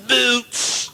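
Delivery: high parade-ground yell; sergeant's drawn-out order. Acted. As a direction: call it out like an army sergeant. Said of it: "the sergeant says to my mate".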